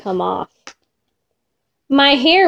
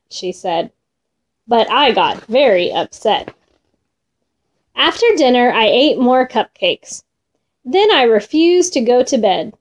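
ordinary delivery

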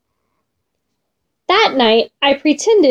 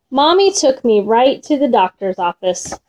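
ordinary delivery

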